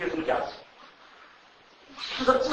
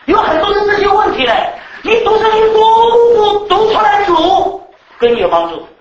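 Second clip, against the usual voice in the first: loud, high, laughing exhortation; laughing preaching; 11 semitones higher, 10 dB louder than usual. Acted. Preacher laughing at endless Bible reading, loud and amused. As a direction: preach it with a grin, full volume.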